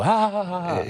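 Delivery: high, mock laughter; imitated audience giggle. Comic showing how the audience reacts, mock giggle. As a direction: fake a crowd's big laugh. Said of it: "That get a big giggle".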